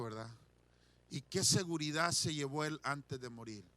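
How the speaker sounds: soft, hushed preaching; quiet reflection. Acted. Preacher musing softly on the thief in heaven, hushed. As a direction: draw them in quietly.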